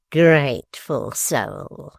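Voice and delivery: very slow sneering praise; mocking. A narrator's reading. Quilp mocking his mother-in-law, slow sneer. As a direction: drawl the mockery. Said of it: "cried the dwarf".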